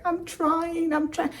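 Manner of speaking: high, thin whiny voice; panicked pleading. Retold or imitated. Trapped driver answering the woman at the window, panicked.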